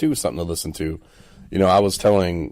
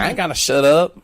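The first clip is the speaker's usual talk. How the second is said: much higher, indignant mock voice; defiant sass; 9 semitones higher, 6 dB louder than usual. Host imitating a defendant sassing her lawyer, high and indignant. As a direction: talk back with attitude. Woman talking back, imitated.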